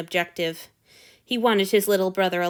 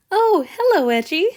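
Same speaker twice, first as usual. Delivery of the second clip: high, honeyed hello; sweet greeting; 10 semitones higher, 7 dB louder than usual. A narrator's reading. Hanyu greeting Edgy at the door, honey sweet. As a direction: sweeten the voice for the guard. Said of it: "as sweet and smooth as fine honey".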